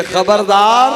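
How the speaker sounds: high, loud rebuke; anguished warning. Acted. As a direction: cut him off with a loud cry. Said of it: "इसकी बीवी तड़प कर कहती है". (तड़प कर ऊँची चेतावनी)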